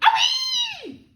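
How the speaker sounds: piercing high, loud squeal; gleeful scream. A narrator's reading. Grandma after her second dose, gleeful scream. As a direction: scream it out in one long whoop. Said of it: "She screamed".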